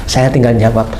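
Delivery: normal talking voice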